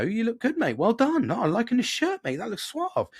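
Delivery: high, bright-eyed praise for a mate's haircut; hearty mock compliment. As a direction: pump him up like a lad in the pub. Acting out complimenting a man.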